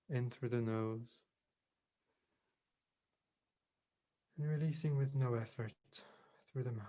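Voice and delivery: unhurried meditation voice with long pauses; guided meditation. Real, not acted. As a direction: gently guide them into calm, leaving space between phrases.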